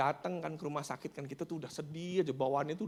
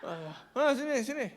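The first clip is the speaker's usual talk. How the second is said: high, loud, distorted voice; put-on strained patient voice; injured friend imitation; 12 semitones higher, 8 dB louder than usual. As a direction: mimic thanks through a battered mouth. Imitating injured friend beckoning visitors.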